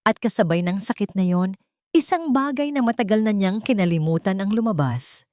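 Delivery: ordinary delivery, nothing marked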